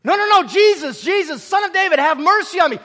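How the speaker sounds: high, loud acted plea; crying out. Blind beggar begs Jesus for mercy over the crowd, crying out. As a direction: call out urgently over people hushing you. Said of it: "He begins to cry out".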